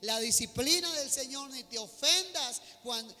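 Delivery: emphatic Bible proclamation at full voice; forceful scripture reading. Real, not acted. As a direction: declaim the verse to the whole congregation.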